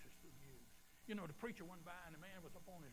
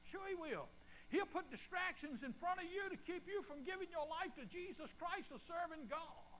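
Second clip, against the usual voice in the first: high, loud preaching; full-force preaching; 11 semitones higher, 4 dB louder than usual. Acted. Preacher warns the congregation about distractions, preaching hard.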